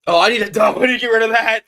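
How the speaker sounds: loud, high rush of alarm; alarmed outburst. Real, not acted. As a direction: blurt it out loudly, high-pitched. Streamer alarmed at possibly banned content.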